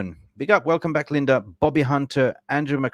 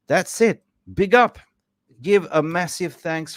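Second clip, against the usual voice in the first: higher and louder, a burst of joy; excited shout-out; 7 semitones higher, 4 dB louder than usual. Real, not acted. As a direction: pump it up for the chat. Excited repeat of end of gifts.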